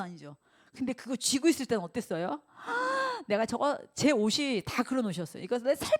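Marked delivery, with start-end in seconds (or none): 2.6-3.2 sudden high gasp; sharp high gasp. Retold or imitated. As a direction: gasp in alarm. 집착할 때의 놀람. (높고 짧게 헉 하고 놀라는 소리)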